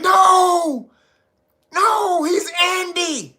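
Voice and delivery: high, drawn-out hollered correction; mock insistent shout. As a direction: imitate a crowd yelling a correction, stretching each word. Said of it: "He was letting everybody around him like".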